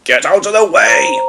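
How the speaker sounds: lightly voiced booming engine; a touch of a big engine's boom; mild booming Gordon. A narrator's reading. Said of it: "boomed Gordon".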